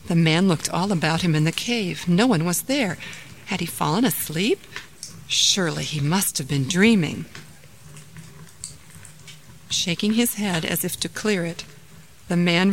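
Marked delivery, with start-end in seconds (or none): none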